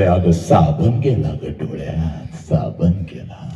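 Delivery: low, slow, subdued reply; poor father's excuse. Acted. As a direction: make a soft, halting excuse to his wife. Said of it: "ते बिचारं गरीब म्हणते".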